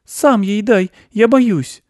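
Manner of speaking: put-on very high, loud girl's squeal; high-pitched little girl refusing in fright; scared little girl.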